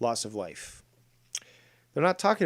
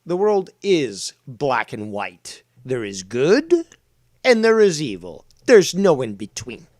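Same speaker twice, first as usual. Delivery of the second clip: booming moral declaration; forceful declaration; 9 dB louder than usual. Real, not acted. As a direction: hammer each word down louder and louder.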